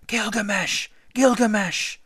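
put-on high-pitched clamoring boys; high, eager children's cheer; little boys shouting. A narrator's reading. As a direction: shout back like excited small boys. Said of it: "clamoring little boys shouted back".

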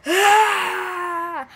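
high, loud, breathy fake cry; mock loud wail. Shown as a demonstration. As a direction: wail out one long pretend sob. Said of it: "I don't cry like".

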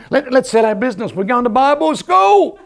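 high, slowing, thrilled "We're going to Bible school"; excited announcement. Retold or imitated. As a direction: burst out the big decision to his wife. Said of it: "I said to Jen".

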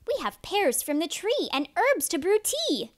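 lightly voiced birds.

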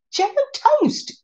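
high, slow, amazed delight. Real, not acted. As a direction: savor the words in wonder. Marvelling at Jell-O toast.

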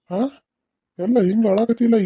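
ordinary talk, nothing marked

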